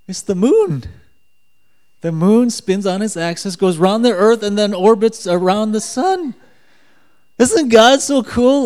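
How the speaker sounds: loud, high, amazed delivery; excited gushing. Retold or imitated. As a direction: gush with building, giddy wonder. Gushing over the riddle's answer.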